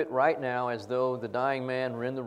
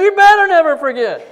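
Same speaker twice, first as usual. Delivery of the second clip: much higher, much louder stern lecture; stern shout; 20 dB louder than usual. Retold or imitated. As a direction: drive the point home at full volume. Professor scolding loudly.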